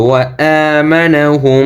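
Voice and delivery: "আমানাহুম" chanted with a long humming final m; slow recited "আমানাহুম", sustained nasal close; ghunna. Shown as a demonstration. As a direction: hold the nasal m at the end of "আমানাহুম". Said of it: "গুণ হবে".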